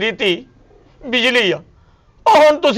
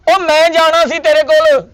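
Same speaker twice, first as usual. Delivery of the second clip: higher and louder, venting at his wife; angry outburst; 6 semitones higher, 4 dB louder than usual. Acted. Husband blurts out his complaint to the family, angry and loud. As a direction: burst out in frustration.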